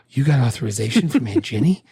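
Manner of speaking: sarcastic disbelief with a laugh. Retold or imitated. Cruise mocking the lawyer about Aunt Ginny, laughing sarcastically. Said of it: "so sarcastically goes".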